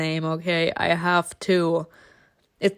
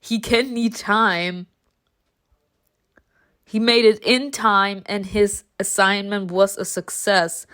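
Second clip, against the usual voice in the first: ordinary delivery, nothing marked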